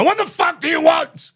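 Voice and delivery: hammed-up angry shout at a caller; mock shout. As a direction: bark it like a stage villain. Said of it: "I go".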